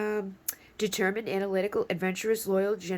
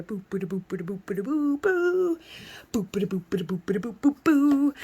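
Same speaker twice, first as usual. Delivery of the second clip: playful boop noises; 2 semitones higher, 4 dB louder than usual. Acted. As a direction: imitate a little charging-off tune in boops. Mimes charging out of here.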